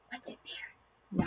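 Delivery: hushed, slow whisper; soft suspenseful whisper for a child; curious whisper. Shown as a demonstration. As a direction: whisper it as if peeking at a secret. Peeks into the next flap.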